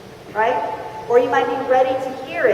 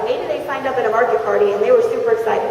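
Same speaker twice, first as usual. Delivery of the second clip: ordinary talk, nothing marked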